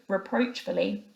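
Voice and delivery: usual speaking voice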